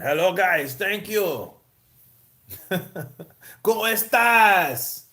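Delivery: lightly voiced Golovkin with a Mexican lilt, ¿Cómo estás? drawn out slowly; a hint of a Mexican accent, high and playful; mock Mexican Golovkin. Streamer mocking Golovkin's new Mexican style, light accent. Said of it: "Mexican style".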